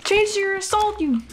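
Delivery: high, loud desperate begging; panicked please please; frantic pleading. Real, not acted. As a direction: plead for your life, panicking.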